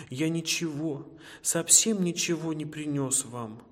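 plain reading voice

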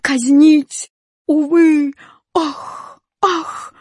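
very high wails ending in gasps; sobbing. A narrator's reading. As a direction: wail each word separately in despair. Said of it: "Зарыдал восклицательный". (высокие рыдающие возгласы)

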